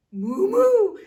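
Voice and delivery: very high, loud mooing; cow crying out. Acted. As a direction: wail it like a frightened cow. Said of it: "Gerda cried".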